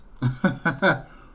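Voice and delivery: sudden loud burst of real laughter; laughing. Real, not acted. Reacting to the Little Brothers name, bursting into loud laughter. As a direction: crack up at the silly name.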